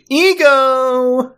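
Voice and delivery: high, loud exclaimed punchline; punchline shout. Retold or imitated. Doctor's punchline in quoted joke.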